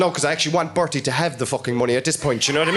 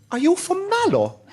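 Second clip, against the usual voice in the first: much higher robber voice; 13 semitones higher than usual. Retold or imitated. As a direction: pitch it right up, curious.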